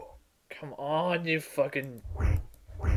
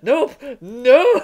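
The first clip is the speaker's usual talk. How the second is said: panicked no; 7 semitones higher, 8 dB louder than usual. Real, not acted. Fleeing dead end.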